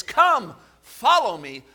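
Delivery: ringing high call; loud call. Acted. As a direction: call out boldly. Jesus calling Levi.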